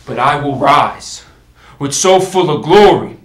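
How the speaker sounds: building stage tirade of vengeance; thunderous royal threat. Acted. King threatening France with war over the Dauphin's mock, loud and wrathful.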